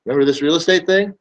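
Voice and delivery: upbeat reminder. Retold or imitated. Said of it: "I'll go".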